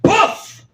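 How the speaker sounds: squeaky high poof sound effect; magic poof. Pretend. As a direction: pop it out like a magic trick.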